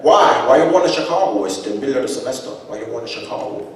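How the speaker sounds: put-on loud, high, fast old father grilling; suspicious grandfather. Grandfather grilling his son about leaving school. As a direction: pepper the son with questions. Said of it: "He says".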